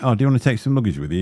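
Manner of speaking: ordinary talk, nothing marked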